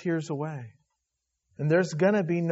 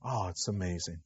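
hushed awe; 10 semitones lower, 11 dB quieter than usual. Real, not acted. Awed aside after preaching.

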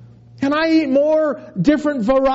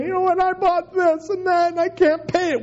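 higher, hammed-up whimpering complaint; mock crying; 9 semitones higher than usual. Preacher mocking a spender crying over debts. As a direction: whine about unpaid bills. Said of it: "And I'm crying".

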